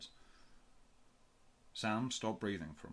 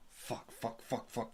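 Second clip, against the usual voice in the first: muttered panic; 2 semitones higher, slower than usual. A narrator's reading. Panicking alone under his breath.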